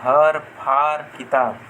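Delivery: काम, हर, फार said slowly without nasal; slow example words. Shown as a demonstration. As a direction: spell out each example word slowly. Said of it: "जैसे काम". (धीमे, बिना नाक के उच्चारण)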